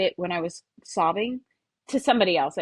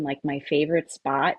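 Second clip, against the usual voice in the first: ordinary talk, nothing marked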